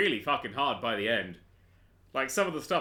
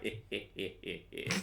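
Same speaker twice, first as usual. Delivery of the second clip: mock grunts; 7 semitones lower, 10 dB quieter, faster than usual. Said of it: "I'm like".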